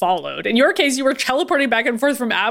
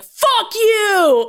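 angry shout; 10 semitones higher than usual. Retold or imitated. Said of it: "Magneto is already flipping out".

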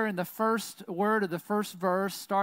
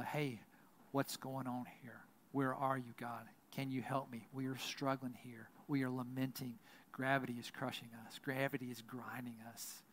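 softer, low, weary plea to God; hushed pleading prayer; 5 semitones lower, 11 dB quieter than usual. Acted. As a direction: murmur the struggle to God, worn down. Voicing the book's closing lament.

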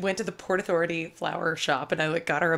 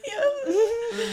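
mock tearful yeah.

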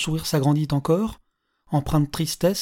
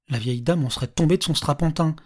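ordinary delivery, nothing marked

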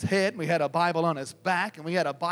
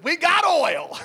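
loud, high triumphant announcement; excited call; 9 semitones higher, 7 dB louder, slower than usual. Retold or imitated. Man back from the stage announces his find, excited holler. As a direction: holler the discovery to the group.

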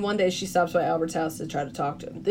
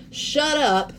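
higher-pitched retold cutoff; mock indignant; 7 semitones higher than usual. Retelling Chloe's mom silencing Eva, mock indignant. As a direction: cut her off, acting indignant. Acted mother cutting off Eva's defense.

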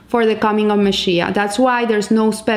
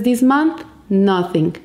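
ordinary talk, nothing marked

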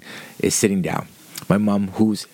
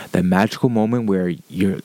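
ordinary talk, nothing marked

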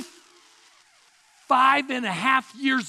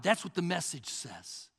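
ordinary talk

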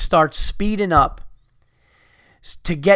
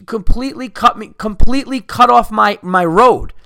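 louder and higher, outraged at being cut off; furious outburst; 6 semitones higher, 6 dB louder than usual. Real, not acted. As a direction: spit out the outrage, building into a near shout. Ranting about being cut off.

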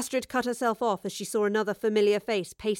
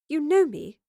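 higher, mildly puzzled question; puzzled frown; 6 semitones higher, 4 dB louder than usual. Acted. Woman questioned by a girl she doesn't know, puzzled. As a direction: ask it with a frown, pitch lifted. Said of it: "the woman frowned".

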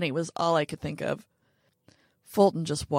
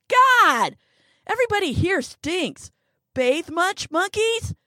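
much higher and louder, jeering; brash mocking yell; loud, scornful shout; 12 semitones higher, 9 dB louder than usual. A narrator's reading. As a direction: yell the insult at the whole office. Margot insulting office loudly.

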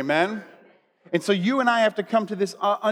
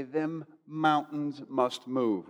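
Southern accent; 2 semitones lower, 6 dB quieter, much slower than usual. Shown as a demonstration. Preacher modelling the line for the congregation, slow Southern accent. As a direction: draw it out softly in a Southern accent. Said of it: "with your best Southern accent".

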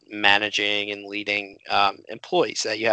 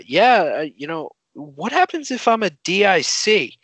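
put-on higher, light Australian accent; imitated Australian classmate; 6 semitones higher than usual. Australian student asking the teacher, imitated Australian accent. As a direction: ask innocently in a put-on Aussie voice. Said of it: "So this Australian raises her hand".

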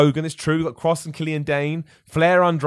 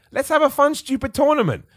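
excited; 12 semitones higher, 3 dB louder than usual. Real, not acted. Enthusiastic pitch for silly idea.

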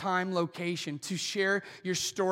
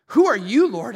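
startled cry; 3 semitones higher, 9 dB louder than usual. Retold or imitated. Saul cries out to the voice.

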